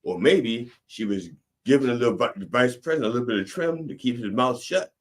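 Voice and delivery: ordinary delivery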